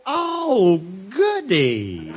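sarcastic, high mock delight. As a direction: fake cheer dripping with sarcasm. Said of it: "Don't be sarcastic".

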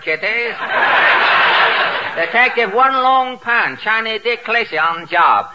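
mock Chinese detective; caricatured Chinese accent, self-important sleuth; One Long Pan. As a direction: announce yourself as a hammy stage-Chinese detective.

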